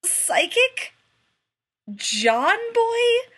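high, loud disbelieving question; can't believe what he just heard; incredulous. Acted. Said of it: "Rodney asked, incredulously".

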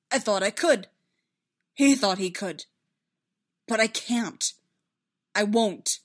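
loud, halting, impassioned confession; anguished insistence. A narrator's reading. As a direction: force out each phrase, swelling with distress. Anguished confession, straining for control.

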